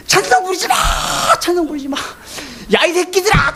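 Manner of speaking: high, strained imitation of demonic shouting; possessed man's voice. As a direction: mimic a possessed person cursing the congregation. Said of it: "굵직한 남자 목소리가 막 나와요".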